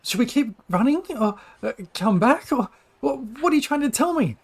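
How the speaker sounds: high, halting, puzzled questions; hesitant Tali. Acted. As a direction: falter through it, pitch climbing. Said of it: "she looks hesitant".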